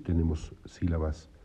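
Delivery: normal talking voice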